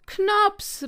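high, loud cry after a friend; desperate call. A narrator's reading. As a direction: call out in despair. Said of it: "rief Fips verzweifelt".

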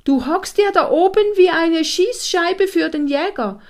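loud, high-pitched scolding order; commanding voice. A narrator's reading. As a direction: order him down, urgent and loud. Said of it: "dieser befehlenden Stimme". (laut und hoch, im Befehlston)

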